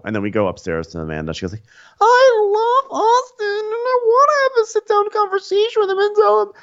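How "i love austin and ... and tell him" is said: very high, loud mock blubber; hammed-up weepy meltdown. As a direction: imitate a tearful, wailing explanation. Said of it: "a blubbering mess at this point".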